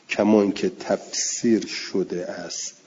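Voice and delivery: ordinary talk, nothing marked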